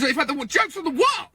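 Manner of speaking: high, rising exclamation; exclaiming. Real, not acted. Brit declaring the joke is global, high and exclaimed. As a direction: land the punchline high. Climax of rant.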